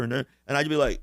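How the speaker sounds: ordinary delivery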